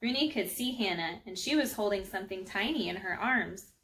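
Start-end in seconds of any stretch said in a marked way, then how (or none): none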